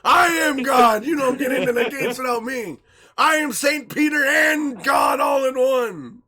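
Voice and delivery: loud, drawn-out bellow from on high; mock grandiose shout. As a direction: proclaim it like a self-appointed deity. Mock boast as all-powerful gatekeeper.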